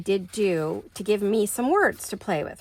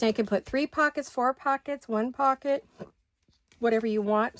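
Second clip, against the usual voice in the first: hiccups; 3 semitones higher, 4 dB louder than usual. Real, not acted. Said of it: "Sorry, I have the hiccups".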